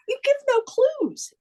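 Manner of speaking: normal talking voice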